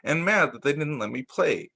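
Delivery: gentle picture-book read-aloud; reading to children. A narrator's reading. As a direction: read it like storytime for little kids.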